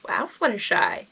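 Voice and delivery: usual speaking voice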